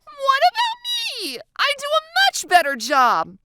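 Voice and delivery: high, loud indignant shriek; animated high outburst. A narrator's reading. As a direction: shriek in wounded protest. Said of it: "she shrieks out".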